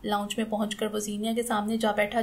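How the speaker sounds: usual speaking voice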